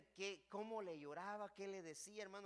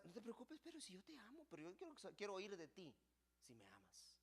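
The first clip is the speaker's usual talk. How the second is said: much softer, gentle and loving; tender reassurance; 10 dB quieter than usual. Acted. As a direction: reassure him softly. The Lord reassures Pedro. (el Señor consuela a Pedro con ternura)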